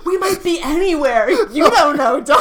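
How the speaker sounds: high, loud, laughing warning; laughing mock warning. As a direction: cry it out through laughter.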